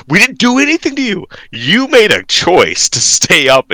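high-pitched defensive retort, then slowed; hammed-up parental pushback; mock protest. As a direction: argue back in exaggerated exasperation. Mock arguing back at complaining child.

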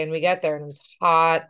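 usual speaking voice

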